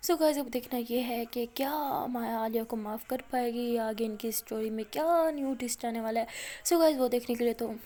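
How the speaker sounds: ordinary delivery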